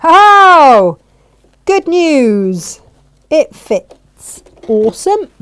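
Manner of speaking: loud, high, real burst of delight; delighted relief. Real, not acted. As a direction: celebrate a happy surprise out loud. Delighted that the piece fits.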